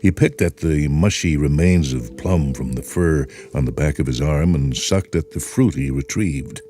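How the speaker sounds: plain reading voice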